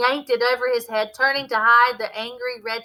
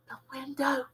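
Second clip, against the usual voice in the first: whispered; 4 semitones higher, 8 dB quieter than usual. Acted. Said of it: "he whispered".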